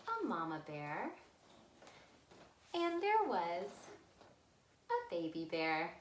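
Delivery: ordinary delivery, nothing marked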